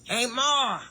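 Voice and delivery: high, far-off call of "Hey, Ma"; imitating son calling. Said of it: "I can't do it the way he did".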